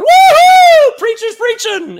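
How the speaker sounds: very high, loud whooping cheer. Acted. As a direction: whoop it up like a thrilled churchgoer.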